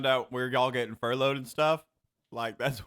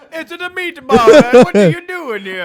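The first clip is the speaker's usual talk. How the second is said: much higher, louder goofy Brooklyn shout; mock Brooklyn Italian accent; 12 semitones higher, 15 dB louder than usual. Topping his friend's Brooklyn impression, loud mock accent. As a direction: belt it like a cartoon Brooklyn guy. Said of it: "You're gonna do my Chinese one".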